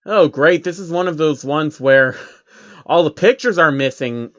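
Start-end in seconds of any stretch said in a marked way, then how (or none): none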